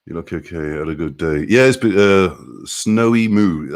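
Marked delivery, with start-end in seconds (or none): 2.0-3.8 "snowy moo" drawn out slowly, as if testing the sound; careful name pronunciation. Shown as a demonstration. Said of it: "I think that's how you're saying it".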